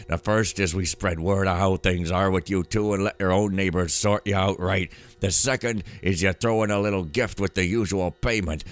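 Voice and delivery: emphatic, threatening character read; manly-voiced woman. Woman warning Wilson about his nights with Harry, manly character voice. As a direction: lean hard on the words like a shakedown. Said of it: "that's how she talks".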